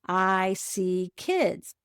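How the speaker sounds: slow, counting each word; saying slowly. Shown as a demonstration. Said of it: "each time you do that, you count the words".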